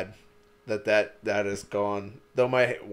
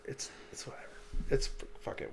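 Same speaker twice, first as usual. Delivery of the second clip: whispered resignation; 14 dB quieter, faster than usual. Real, not acted. Resigned about wife's food.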